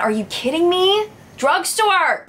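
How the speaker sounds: amazed. Real, not acted. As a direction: shout it out, thrilled. Amazed at a cheap shade.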